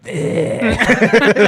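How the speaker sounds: high, loud drawn-out "eh"; mock a drunk friend's goofy noise; imitated drunken bleat. Friend's drunken noise after stonewalling.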